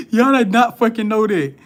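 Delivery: loud, high, amazed outburst; excited exclaim. Real, not acted. Streamer amazed at the new moon in Libra, loud and excited. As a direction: throw it at the chat, thrilled.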